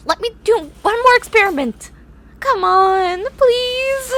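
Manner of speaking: whiny protest. Acted. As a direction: squeal and plead against the shower. Protesting being forced into shower.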